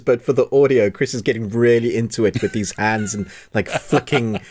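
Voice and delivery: usual speaking voice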